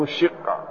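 "الشقة" as ash-shiqqa; short i after the shin in "الشقة"; kasra on the shin. Shown as a demonstration. Teacher repeating Ubayd's reading, "الشقة" said ash-shiqqa with i. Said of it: "بكسر الشين".

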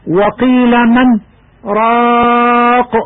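من held, then a short silent break before راق; pause in the phrase. Shown as a demonstration. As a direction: chant من, stop briefly without breath, then راق. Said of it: "الكلمة الثالثة". (سكتة لطيفة بين من وراق)

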